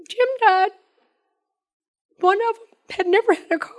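holding back tears as she speaks; tearful. Real, not acted. Widow remembering her late husband to the group, tearfully. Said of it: "I knew I didn't wanna cry".

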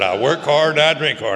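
old guy's loud boast, retold; mock barroom boast. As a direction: puff up like an old drunk bragging "I work hard and I drink hard". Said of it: "He said".

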